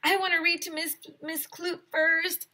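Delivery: put-on eager child pleading to read first; shouted. A narrator's reading. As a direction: demand the first turn. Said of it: "shouted Andrea".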